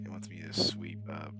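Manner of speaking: hushed whisper to himself. Real, not acted. Reading Granny's chore order to himself, in a whisper. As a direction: whisper it under your breath.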